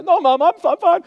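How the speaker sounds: put-on high, loud little-kid protest; scared kid voice. Child pleading with a scolding mom, high and quick.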